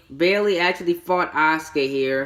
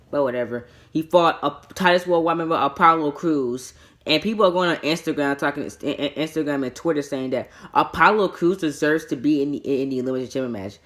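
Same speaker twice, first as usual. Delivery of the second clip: ordinary talk, nothing marked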